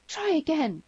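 put-on high, urgent girlish cry; high-pitched eager daughter; girl's cry. A narrator's reading. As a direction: cry out encouragement, high and loud. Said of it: "cried the girl".

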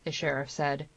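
ordinary delivery, nothing marked

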